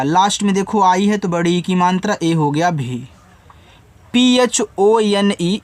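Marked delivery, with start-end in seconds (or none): 4.1-5.6 letters spelled out one by one, very slowly; slow letter-by-letter spelling. Shown as a demonstration. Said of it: "इसको पढ़ते हैं फोन".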